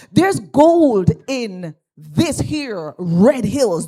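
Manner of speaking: preaching emphasis. Real, not acted. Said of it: "emphatic sermon proclamation".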